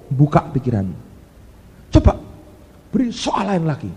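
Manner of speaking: ordinary talk, nothing marked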